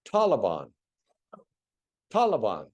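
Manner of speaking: "Taliban" with a broad ah, TAH-luh-bahn. Shown as a demonstration. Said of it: "if you would repeat after me".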